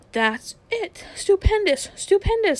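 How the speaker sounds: Uncle Andrew crowing high and loud with delight; gleeful exclamation. Acted. Old uncle marvelling at the growing lamppost, crowing gleefully high and loud. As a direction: cry it out, thrilled at his discovery.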